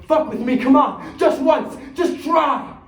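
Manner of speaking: high, loud, goading challenge; heated taunt at full volume; defiant dare. Acted. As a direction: dare them to make a move, shouting. Hero daring the gunman.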